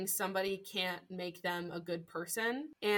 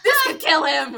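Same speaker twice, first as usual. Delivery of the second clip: laughing mock plea; 6 semitones higher, 12 dB louder than usual. Mock distress over Rory.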